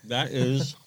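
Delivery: talking through a mouthful; words around a bite of cookie; chewing while talking. Real, not acted. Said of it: "I'm sorry if I'm chewing on the mic here".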